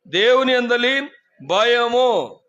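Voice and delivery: slow, stressed on దేవుని; emphatic call phrase. Shown as a demonstration. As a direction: lead the phrase for the crowd to repeat, slow. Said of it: "చెప్పండి".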